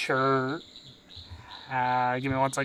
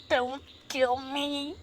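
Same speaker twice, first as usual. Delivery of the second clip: slow, high-pitched plea; grovelling; 8 semitones higher, 3 dB quieter, slower than usual. Acted. Goblin drops down with hands up, grovelling plea. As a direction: beg slowly in a high goblin voice.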